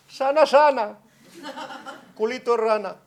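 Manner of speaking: high, loud sing-song granny chant with a laugh; grandma's rhyme. Retold or imitated. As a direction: chant it like a grandma soothing a toddler. Grandma's nursery rhyme to child.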